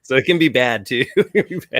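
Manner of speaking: laughing through the words; chuckling it out, amused. Real, not acted. Laughing at his own joke.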